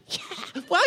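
airy, breathless mock thrill-scream with a laugh; half-whispered whoop of a rider going downhill; mock coaster scream. Coaster rider going down the hill, breathy mock scream. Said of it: "you're screaming".

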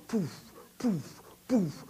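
soft, airy "poof" repeated; imitated tumbling thuds. Rider pictures himself tumbling down the hill, puffed thuds. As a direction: puff out each bounce separately.

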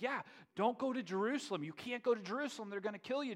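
high, urgent pleading; alarmed protest. Retold or imitated. Disciples warning Jesus off Jerusalem, alarmed high-pitched protest.

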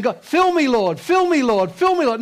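high, repetitive mock pleading; needy churchgoers praying. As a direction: beg over and over like an eager congregant. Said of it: "churches with people today that are going".

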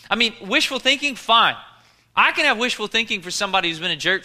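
high, driving rhetorical preaching; forceful preaching. Real, not acted.

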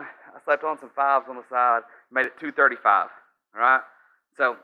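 ordinary talk

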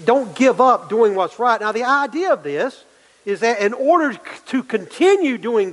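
preaching with a lifted pitch; fervent pulpit push; rising preaching. Acted. Preacher building intensity.

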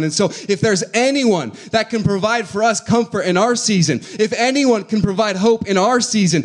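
loud, high, building sermon climax; preaching. Acted. Pastor assuring the congregation Jesus knows pain, preaching loud. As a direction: lift the room with hope.

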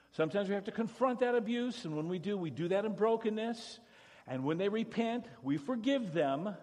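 loud, fervent summary; preaching at full force. Real, not acted. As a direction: push the summary with full energy.

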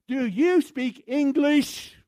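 slow, loud, high Do you speak English; over-enunciate and yell at the foreigner; an American yelling English abroad; yelling slowly. Retold or imitated. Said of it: "I don't know why we yell at people in foreign countries".

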